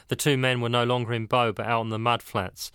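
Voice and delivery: plain reading voice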